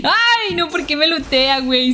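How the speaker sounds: normal talking voice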